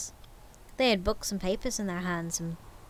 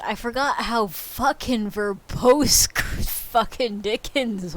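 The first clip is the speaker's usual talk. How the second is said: laughing; 6 dB louder, slower than usual. Real, not acted.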